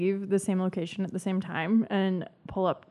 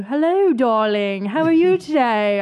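imitated sweetheart greeting; 4 dB louder than usual. Imitating his greeting once they were dating, exaggerated. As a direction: play an over-the-top darling greeting. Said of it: "And then it turned into".

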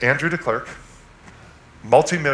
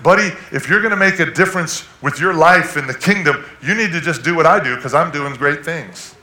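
cocky advice; 5 semitones higher, 7 dB louder than usual. Retold or imitated. Pastor mocks his past boastful self.

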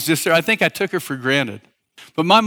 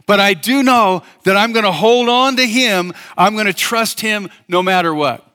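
full-force preacher's fervor; fervent preaching; 5 semitones higher than usual. Acted. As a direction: preach it with rising conviction.

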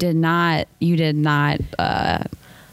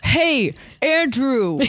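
higher-pitched hailing call, lightly mimicking a pier guy; imitating a dockside holler; 6 semitones higher, much slower than usual. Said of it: "I sounded like some guy from the pier".

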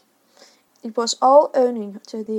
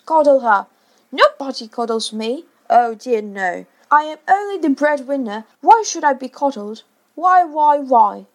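self-pitying rant; 4 semitones higher, 8 dB louder than usual. A narrator's reading.

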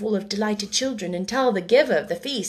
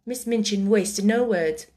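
ordinary delivery, nothing marked

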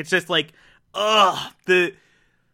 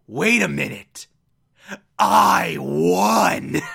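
Light's triumphant realization; slower than usual. Retold or imitated. Light realizes his plan worked.

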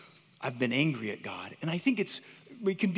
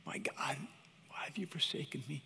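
hushed whisper; 12 dB quieter than usual. Acted. Jesus forsaken on the cross, whispered lament. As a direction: whisper it, broken and soft.

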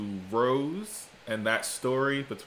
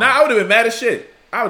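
loud; 11 semitones higher, 13 dB louder, faster than usual. Real, not acted. Emphatic reaction.

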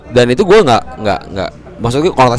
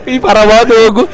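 laughing; 10 semitones higher, 6 dB louder than usual. Real, not acted. Laughing at his own confession.

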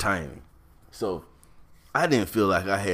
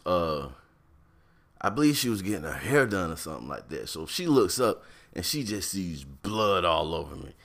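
normal talking voice

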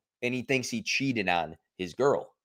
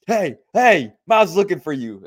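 high, loud call through the window; loud mock call from outside the window; banging on the window shouting; 7 semitones higher, 7 dB louder than usual. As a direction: yell it to get his attention. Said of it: "my job to be like, bang on the window".

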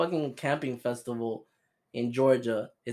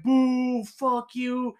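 mock crowd booing; 8 semitones higher, much slower than usual.

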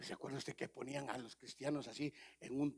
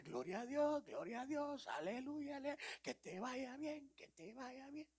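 mocking wife; 11 semitones higher, slower than usual. Wife bidding her husband goodbye in a high mock-sweet voice. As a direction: wave him off in a high sugary voice. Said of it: "dice la mujer".

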